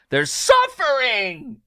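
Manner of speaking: high drawn-out mock wail; overplayed cry of distress; mock anguish. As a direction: draw it out in exaggerated pity. Mock outcry at others' hardship.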